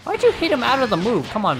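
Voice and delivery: ordinary talk, nothing marked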